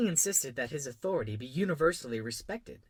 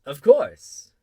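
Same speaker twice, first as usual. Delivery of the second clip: loud royal reply; self-assured king; 3 semitones higher, 17 dB louder than usual. A narrator's reading. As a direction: answer grandly as absolute monarch. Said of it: "the king replied".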